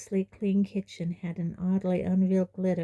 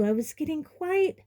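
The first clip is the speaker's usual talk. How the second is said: high fussing mother; a worried mother trailing off; mother fretting at the door; Aunt Petunia; 9 semitones higher than usual. A narrator's reading.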